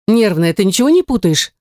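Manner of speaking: a high, startled note of outrage on Что; pushing back at a friend, lightly offended; indignant. A narrator's reading. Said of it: "возмутилась я".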